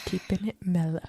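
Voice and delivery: whisper. Acted. Playful whispered echo.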